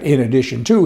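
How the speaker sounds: ordinary talk, nothing marked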